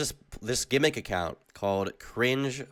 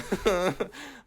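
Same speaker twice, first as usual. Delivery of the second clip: nervous laugh; 9 semitones higher than usual. Real, not acted. Laughing after the spider scare.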